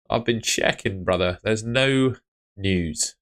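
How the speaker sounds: normal talking voice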